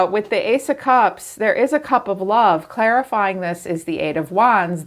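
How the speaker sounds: ordinary delivery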